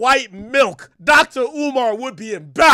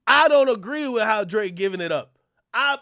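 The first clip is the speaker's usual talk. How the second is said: ordinary talk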